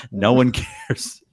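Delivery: drawn-out, dry "no one cares"; slow it down, teasing; slow dismissive. Real, not acted. Teasing about the song.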